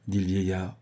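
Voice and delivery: ordinary delivery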